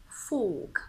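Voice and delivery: FORK in British English, R dropped; British accent. Shown as a demonstration. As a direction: round the lips for a British FORK without R. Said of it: "In the British accent".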